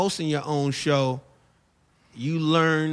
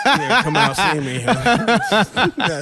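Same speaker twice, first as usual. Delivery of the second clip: laughing; 3 semitones higher, 4 dB louder, much slower than usual. Real, not acted. Laughing off the request.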